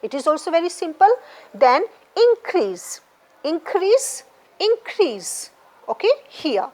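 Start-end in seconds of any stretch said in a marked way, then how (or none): 2.1-5.5 "increase" slowly, as in-CREASE; weight on the second syllable of "increase", repeated; stress on CREASE. Shown as a demonstration. Teacher showing where stress goes, second syllable stressed. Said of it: "You will mark stress on the right syllable".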